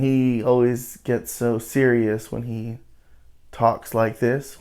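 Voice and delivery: slow and soft, with grave pauses; serious slow talking. Retold or imitated. Host mimics how he talks to the women, slow and serious. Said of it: "he's, like, going slow".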